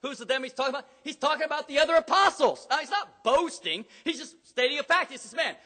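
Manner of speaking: loud, high-pitched sermon delivery; fiery preaching. Acted. Pastor preaching to the church at full force.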